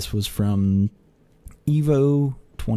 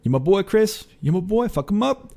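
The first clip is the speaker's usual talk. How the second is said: cheering on a friend; 9 semitones higher than usual. Retold or imitated. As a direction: hype up your boy from behind the stage. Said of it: "and I'm cheering".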